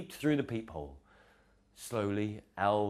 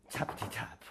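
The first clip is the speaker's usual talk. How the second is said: knocking sound; 6 dB quieter than usual. Acted.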